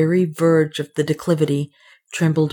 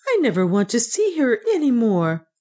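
higher-pitched woman's voice; rejecting heroine; 6 semitones higher than usual. A narrator's reading. Woman rejecting her old servant, upset. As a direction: refuse her in a raised pitch.

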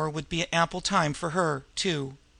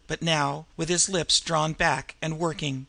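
ordinary delivery, nothing marked

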